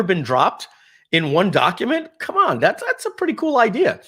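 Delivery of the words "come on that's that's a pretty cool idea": much higher, thrilled and eager; excited. Real, not acted. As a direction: gush over a cool idea. Enthused about a cool tool.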